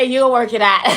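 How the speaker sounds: loud, laughing quote through tears; laughing while quoting. Retold or imitated. Quoting her friend's reply while laughing and teary. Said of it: "She said".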